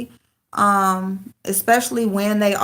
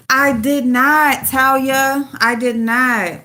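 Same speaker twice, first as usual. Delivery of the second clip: louder, slower insistence; emphatic denial; 5 semitones higher, 6 dB louder, slower than usual. Real, not acted. Streamer denying a claim to viewer Talia, loud and slow. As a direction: firmly set the record straight.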